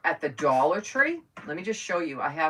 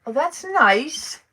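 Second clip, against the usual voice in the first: slow, drawn-out Massachusetts "wicked nice"; a put-on Massachusetts drawl, high and slow; West Massachusetts accent; 10 semitones higher, 8 dB louder, much slower than usual. Shown as a demonstration. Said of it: "That's West Massachusetts".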